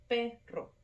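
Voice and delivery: "perro" split into PE, rro; stress on PE. Shown as a demonstration. Teacher showing the tonic syllable, "perro" split with PE stressed. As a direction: say "perro" in two beats, first stronger. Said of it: "la sílaba tónica".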